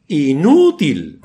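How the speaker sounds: "inútil" with the Ú stretched and loud; stress on the U. Shown as a demonstration. Preacher spells out the verdict "inútil", heavy stress on Ú. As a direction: punch and lengthen the second syllable of "inútil". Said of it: "con acento en la U".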